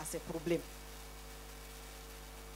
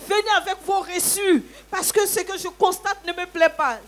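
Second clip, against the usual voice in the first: high, loud accusing order; imitating a cross landlady, high and loud; retold stern demand; 9 semitones higher, 7 dB louder than usual. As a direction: demand the receipts angrily. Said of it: "c'est des cris que j'entends".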